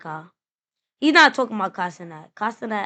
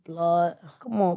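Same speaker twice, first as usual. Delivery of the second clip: usual speaking voice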